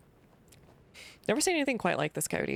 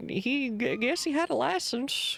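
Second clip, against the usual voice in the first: put-on grumpy old farmer; 6 semitones higher than usual. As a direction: warn strangers off your land. Voices the farmer NPC.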